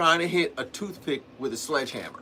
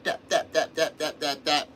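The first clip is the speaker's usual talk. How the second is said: rapid, even, staccato imitated taps; light tapping noises. Shown as a demonstration. Showing how a thin nail goes in, light tapping. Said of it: "If you tap it".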